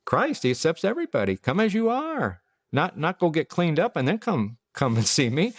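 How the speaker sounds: high, eager preacher inviting; pastor's welcome. Retold or imitated. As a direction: lift your voice to welcome. Quoting the pastor.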